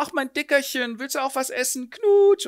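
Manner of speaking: put-on high cooing baby talk; a girlfriend cooing over an infant. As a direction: coo at the chubby baby.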